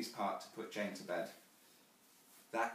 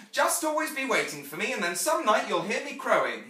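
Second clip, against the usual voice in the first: put-on high, loud boyish parting promise; Peter; 9 semitones higher, 11 dB louder than usual. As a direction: call it out like an eager boy. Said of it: "the last thing he ever said to me".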